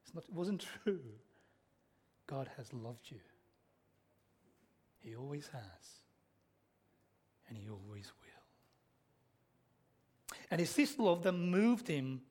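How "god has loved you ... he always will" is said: very soft, low, spaced-out phrases; tender assurance. Real, not acted. Preacher applying love words to God, hushed and tender. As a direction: sink softer with each phrase. Applies film line to God.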